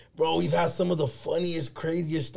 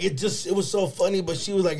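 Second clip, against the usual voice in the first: ordinary delivery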